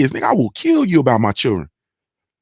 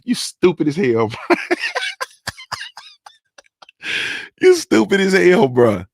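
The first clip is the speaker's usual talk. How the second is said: laughing at chat; 8 semitones higher, slower than usual. Real, not acted. Cracking up at chat comment.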